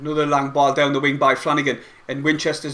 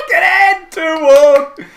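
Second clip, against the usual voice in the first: higher and louder triumphant call; celebrating; 10 semitones higher, 8 dB louder, much slower than usual. Real, not acted. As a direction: punch the air with the score. Celebrating the goal.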